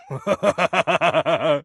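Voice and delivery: high dark chuckle; smug evil laugh; gleeful villain laugh. Acted. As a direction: laugh at the honest answer. Villain amused.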